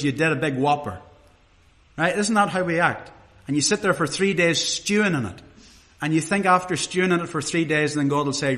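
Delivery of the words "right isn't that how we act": ordinary talk, nothing marked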